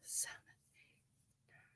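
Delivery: counting stitches in a whisper. Real, not acted. Crocheter counts her chain stitches under her breath, whispering. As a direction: breathe the numbers to yourself.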